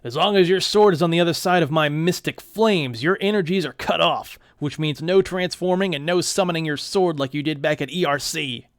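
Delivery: loud, gloating demon villain voice; villain taunt. Acted. Demon taunting Neil behind the flames, loud gloating.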